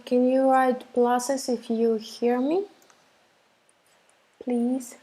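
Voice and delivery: usual speaking voice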